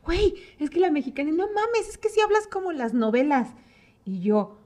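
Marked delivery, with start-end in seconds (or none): 0.1-3.5 higher, teasing mock Costa Rican voice; imitating Costa Rican friends. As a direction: tease her about the telenovela accent. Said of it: "me decían". (amigas costarricenses bromeando, más agudo)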